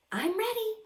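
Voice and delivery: high, bright eager reply. Acted. Amelia answering the pilot before her first flight, high and eager. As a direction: answer like you can't wait.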